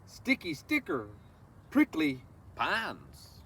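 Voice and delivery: slow, lilting read-aloud of a rhyming book; reading to children. Acted. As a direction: savor each word for the little ones. Reads picture book to children.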